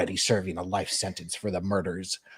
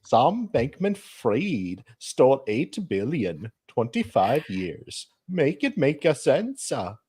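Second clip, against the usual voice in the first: put-on high, sing-song muddled foreign accent; slow, lilting, badly done accent; swooping accent somewhere between Irish and Indian; Nicholas; 7 semitones higher, slower than usual. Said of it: "Do you want to do your Nicholas voice?".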